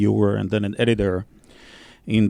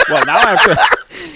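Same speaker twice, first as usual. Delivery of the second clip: laughing; 8 dB louder than usual. Real, not acted. Laughing at the gnome reading.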